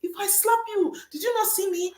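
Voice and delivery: high-pitched indignant challenge; mock confrontation. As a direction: act affronted after being bumped into. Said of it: "Of course, you will not say".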